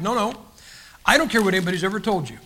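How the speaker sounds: normal talking voice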